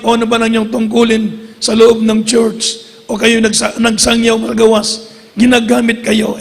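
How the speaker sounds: ordinary delivery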